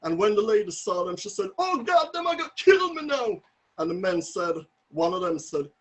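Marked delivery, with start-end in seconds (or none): 1.6-3.4 high-pitched cry of dread; terrified. Retold or imitated. Neighbour lady seeing armed men approach, terrified cry. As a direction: wail it high, sure you are done for. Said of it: "she was so terrified".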